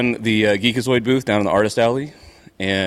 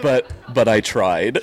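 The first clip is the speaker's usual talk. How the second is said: slow words pushed out through real laughter; can barely get it out for laughing; laughing slow quote; slower than usual. Real, not acted. Telling the con crowd about Rob, slow and laughing. Laughing while retelling Rob's line.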